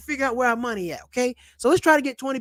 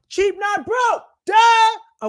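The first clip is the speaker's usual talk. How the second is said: high, loud shouted slogan; catchphrase shout; 9 semitones higher, 5 dB louder, much slower than usual. Real, not acted. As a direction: belt out the catchphrase.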